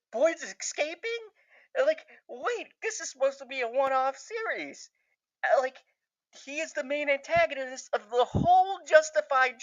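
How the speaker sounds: high-pitched giddy disbelief; shocked joy. Retold or imitated. Fan reacting to the villain's escape, high shocked delight. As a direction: squeal it out, reliving the shock. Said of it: "imagine my shock and my joy".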